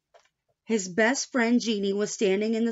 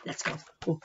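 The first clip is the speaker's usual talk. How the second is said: plain reading voice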